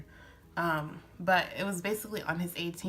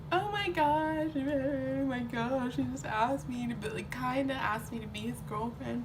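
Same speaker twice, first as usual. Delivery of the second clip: mock crying; 6 semitones higher than usual. Girl retelling being asked to be his girlfriend, mock crying. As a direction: wail it like happy bawling, hammed up. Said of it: "I just remember crying".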